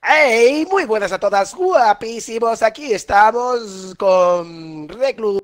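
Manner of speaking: loud, slow hyped greeting; youtuber intro. Acted. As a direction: open the video like a streamer.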